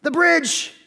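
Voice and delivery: high, loud alarmed cry; a father suddenly remembering the bridge; sudden panic in a high voice; panicked gasp. Retold or imitated. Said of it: "And he's like".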